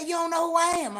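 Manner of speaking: mock offended call. Girl at the register calls out to T, high and loud. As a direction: holler it like you can't believe it.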